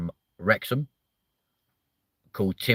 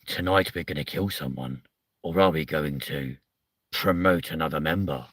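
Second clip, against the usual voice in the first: lower, hushed mock game-show host; lightly voiced Traitors presenter announcing the night; a hint of a solemn host building suspense; dramatic TV host; 5 semitones lower than usual.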